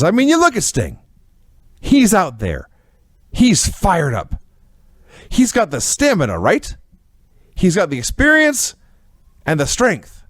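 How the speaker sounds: high, pumped-up interviewer hyping Sting; a hammed-up, rising-pitch fan interviewer; excited David Crockett. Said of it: "and says".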